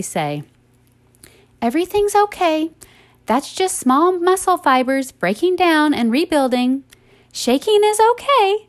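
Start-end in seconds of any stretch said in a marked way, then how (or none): 1.6-8.7 high, sweet, matter-of-fact reassurance; sweet, calm instructor. Retold or imitated. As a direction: imitate a cute, soothing fitness instructor in a raised pitch. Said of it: "very calmly and very matter-of-factly ... said it all sweet and cute".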